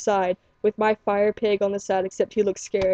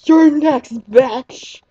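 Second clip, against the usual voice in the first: higher, louder snarling threat; monster threat; 4 semitones higher, 6 dB louder, much slower than usual. Retold or imitated. Fire the Pokemon threatening the trainer, loud and mean. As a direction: spit it out like a gleeful killer. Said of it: "it was like".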